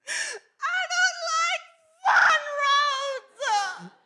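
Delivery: high, slow crying wail; wailing scream. Retold or imitated. Sick little sister screaming from the back seat, crying wail. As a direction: wail it like a carsick kid at the end of her rope. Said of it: "Jemima just screams from the back".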